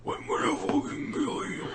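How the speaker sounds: soft voiceless menacing threat; retelling the violent character's sudden threat. Retold or imitated. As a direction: hiss the threat in a raspy whisper. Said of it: "It suddenly went".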